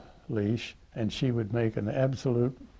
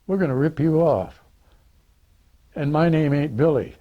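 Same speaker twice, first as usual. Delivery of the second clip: put-on raised, higher tough-kid voice; defiant kid's threat; 6 semitones higher, 6 dB louder than usual. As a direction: act out a punk kid telling him he's getting robbed. Street kid threatening the narrator.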